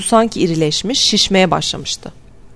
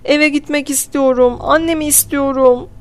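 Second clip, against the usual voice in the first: put-on high-pitched, whimpering little boy; sobbing child; 7 semitones higher than usual. A narrator's reading. Small boy wanting home and mother, acted sobbing. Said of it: "Billy hıçkırmaya başladı".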